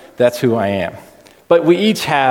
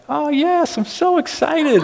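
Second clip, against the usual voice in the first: high-pitched bubbly wife; slower than usual. Retold or imitated. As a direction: imitate an excited wife in a squeaky-high voice. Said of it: "when I do the high-pitched voice".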